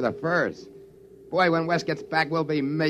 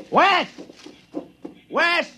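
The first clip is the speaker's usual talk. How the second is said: calling his name, high and loud; high, loud shout of a name; 4 dB louder than usual. Acted. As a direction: search for him with your voice. Calling out for missing friend.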